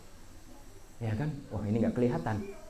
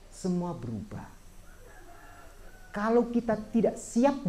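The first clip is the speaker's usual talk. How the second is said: dhamma preaching; 5 semitones higher than usual. Acted. Monk teaching lay followers about change, earnest preaching. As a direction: lay the teaching on the audience, measured and firm.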